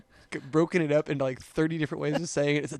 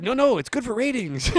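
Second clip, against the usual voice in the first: higher, louder quoted reassurance; mock insistent protest; 9 semitones higher, 7 dB louder than usual. Wife retells a quip about ratings, mock insistent. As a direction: retell it high and loud, playfully.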